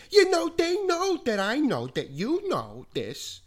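high, loud put-on teacher voice; Mister Weiss impression. Teen mimicking his teacher's assembly talk, high and loud. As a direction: do the quirky teacher, pitched far up. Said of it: "he would say, like".

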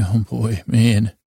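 ordinary delivery

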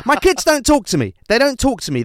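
high-pitched comic despair; mock breakdown. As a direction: wail it as a hammed-up meltdown. Acted on-camera breakdown of presenter.

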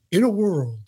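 lightly mimicked trailer announcer voice; a touch of movie-trailer narrator. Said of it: "do the movie announcer voice".

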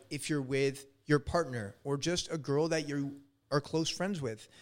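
ordinary talk

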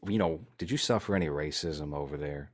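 ordinary talk

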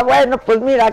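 ordinary talk

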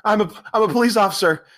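high, pleading mock panic; hammed-up high squeal for help. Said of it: "And he's like".

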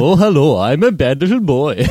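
a hint of a Herzog German accent, playful; Werner Herzog impression. Cohost riffing a light German accent for a joke. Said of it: "Stuart Wellington's Werner Herzog impression".

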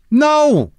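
high, drawn-out "no"; exasperated high cry of no; emphatic shouted no. Acted. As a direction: wail it out as a blunt denial. Acted answer to anxious question.

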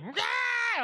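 very high mock scream; sudden high holler, imitated; mock yell. Friend imitates mom yelling at him outside a cafe, high scream. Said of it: "she was yelling at me".

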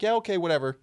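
high, loud careless shrug; dismissive mock voice. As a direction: toss it off like you don't care. Mocking a shrugging agreement.

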